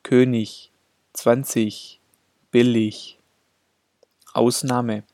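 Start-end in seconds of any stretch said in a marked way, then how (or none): 0.0-3.2 "zwanzig" and "billig" with g turned into front ch; final g as ch. Shown as a demonstration. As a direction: say the -ig in "König" as -ich. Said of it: "wird G als vorderes ch gesprochen".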